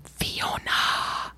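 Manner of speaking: long, drawn-out whispered name; hushed, theatrical reveal; breathy whisper. Pretend. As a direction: whisper it slowly, savoring each sound. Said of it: "say it like that".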